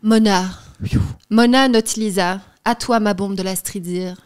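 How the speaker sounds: reading a love letter with flair; dramatic letter reading. Acted. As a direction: perform the letter like a declaration. Reads a love letter aloud.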